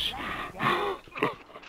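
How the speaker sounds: very high airy giggle; breathy high giggle. Real, not acted. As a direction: squeal a breathless laugh. Laughing with delight.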